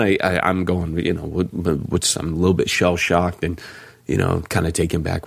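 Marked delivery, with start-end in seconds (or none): none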